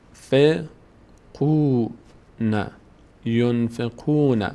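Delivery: drawn-out Quranic chant, syllable by syllable; slow recitation. Shown as a demonstration. Teacher recites slowly for students, chanting. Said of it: "teacher demonstrates Quran recitation".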